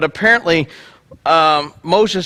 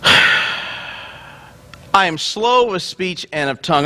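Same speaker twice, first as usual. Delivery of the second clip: slow sigh; 2 semitones lower, much slower than usual. Acted. Moses reluctant excuse.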